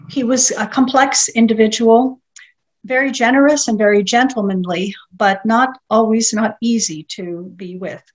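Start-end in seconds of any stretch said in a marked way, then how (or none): none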